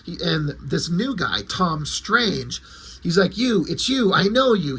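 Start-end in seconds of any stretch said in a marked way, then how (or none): 3.4-4.8 mock yell, pitched higher; high, half-hearted scream of recognition; retold scream. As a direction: imitate a freaked-out cry without full volume. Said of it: "He screams".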